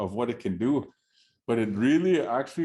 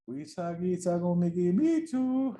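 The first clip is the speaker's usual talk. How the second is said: bird calling out; 6 semitones higher than usual. Acted. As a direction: sing it out like a chattering bird. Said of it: "that bird yells out".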